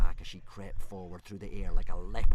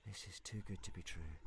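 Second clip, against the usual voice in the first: to himself; 27 dB quieter than usual. A narrator's reading. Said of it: "Hiccup said to himself".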